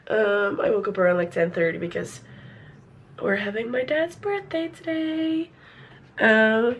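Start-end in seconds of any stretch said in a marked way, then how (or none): none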